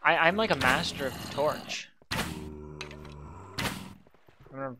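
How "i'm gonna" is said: trailing off, out of breath. Real, not acted. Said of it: "run out of breath here too".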